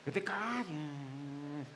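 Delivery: a hushed, slow crowd telling him to shut up; hushing scold. Retold or imitated. Crowd hushes the blind beggar, slow hushed scold. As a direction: hiss them to be quiet, slowly. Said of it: "Muchos le decían".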